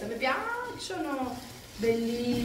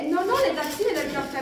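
ordinary talk, nothing marked

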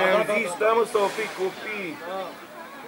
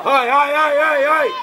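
quick volley of high, loud shouts; urging shout; 5 semitones higher, 5 dB louder than usual. Real, not acted. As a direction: spur the team forward. Urging players during play. (strigăte rapide de încurajare)

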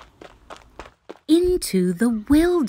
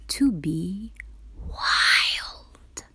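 breathy whisper on wild; 5 dB quieter, much slower than usual. A narrator's reading. Narrator whispering the tiger's secret wish to listeners.

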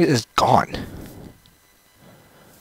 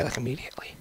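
much softer whispered aside; 14 dB quieter, much faster than usual. Real, not acted. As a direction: drop to a whisper. Aside on game sound.

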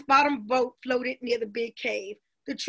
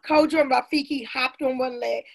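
ordinary delivery

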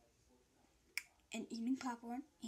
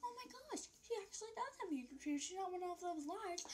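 higher, fast gushing voice of impressed friends; 7 semitones higher, 7 dB quieter than usual. Retold or imitated. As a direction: gush like a shocked classmate. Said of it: "they're gonna think".

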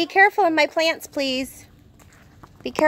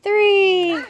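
loud countdown; 5 semitones higher, 5 dB louder, much slower than usual. Real, not acted. Mom counts down the start.

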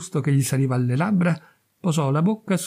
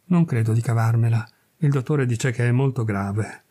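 ordinary delivery, nothing marked

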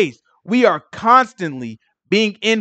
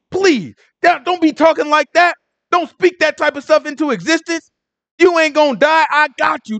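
higher, urgent pleading friend; pleading Peter; 6 semitones higher than usual. Retold or imitated. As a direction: beg him not to talk that way.

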